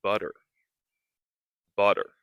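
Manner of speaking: butter, D-like T; flap T demo. Shown as a demonstration. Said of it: "we can practice saying".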